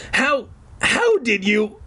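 high, halting frightened stammer. A narrator's reading. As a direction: choke the words out, high and slow. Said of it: "Mickey stammered".

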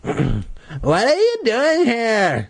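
high, slow suspicious question. A narrator's reading. Louisa questioning the newcomers, suspicious. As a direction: draw it out, distrustful of the strangers. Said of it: "She sounded suspicious".

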